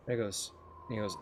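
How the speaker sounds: usual speaking voice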